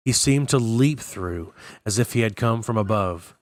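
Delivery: ordinary delivery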